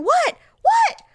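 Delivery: high, loud shout up the stairs; mock bellow from another floor; imitated yelling. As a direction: holler as an alarmed husband downstairs, escalating. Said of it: "did he just keep yelling?".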